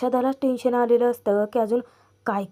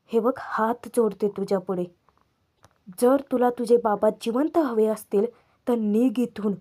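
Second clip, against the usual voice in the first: normal talking voice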